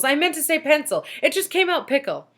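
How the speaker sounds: flustered. A narrator's reading. Teacher flustered after slip.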